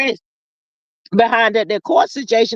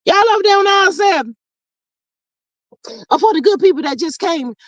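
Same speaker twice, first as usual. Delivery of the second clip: calling out; 5 semitones higher than usual. Real, not acted. Calling out to chat.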